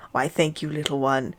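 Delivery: southern twang. Acted. Reverend thanking Harry in a soft Southern accent. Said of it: "a distinctive southern twang".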